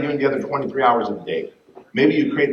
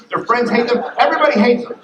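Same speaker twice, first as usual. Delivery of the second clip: ordinary talk, nothing marked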